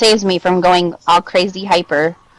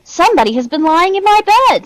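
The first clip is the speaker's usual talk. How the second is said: higher, emphatic mama bear voice; middle-sized Mother Bear; 10 semitones higher, 4 dB louder than usual. A narrator's reading. Mother Bear finds her bed slept in, middle-sized voice. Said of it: "in her middle-sized voice".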